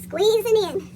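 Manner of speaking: ordinary delivery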